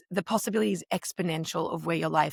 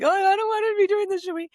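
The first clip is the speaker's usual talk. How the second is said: high, louder mock whine; mock reluctant whine; 13 semitones higher, 5 dB louder, faster than usual. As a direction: whine it high like you want out. Voicing reluctance when low.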